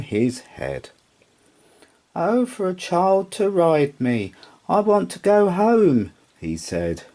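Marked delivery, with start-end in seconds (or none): 2.1-6.1 high, longing little horse; wistful horse voice. Acted. Rocking horse longing to trot, high and wistful. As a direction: sigh out the wish high.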